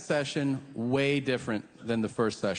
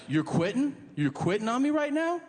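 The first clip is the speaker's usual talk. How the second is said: indignant disbelief; 10 semitones higher than usual. Retold or imitated. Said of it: "He's like".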